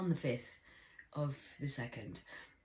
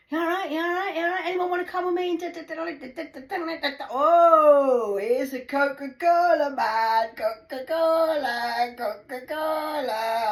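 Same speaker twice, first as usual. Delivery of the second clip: high, loud, hyper singsong; manic young guy; 13 semitones higher, 14 dB louder than usual. Retold or imitated. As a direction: bounce around like someone high. Imitating hyper young man.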